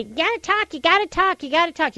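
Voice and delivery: high, nagging press voice; mock pushy reporters.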